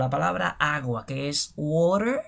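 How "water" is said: "water" with a tapped middle and sounded final r; variant pronunciation. Shown as a demonstration. Teacher showing learners one way to say water, "water" with tapped t and final r. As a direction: say "water" slowly, middle t softened to a tap. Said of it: "se pueden decir de diferentes maneras".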